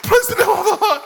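loud, high laughing praise; laughing cry of praise; joyful praise. Real, not acted. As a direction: shout praise with delight. Exclamation of praise.

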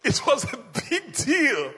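words bubbling through laughter; laughing. Real, not acted. Pastor laughing with the congregation, laughing. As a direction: laugh it out, amused.

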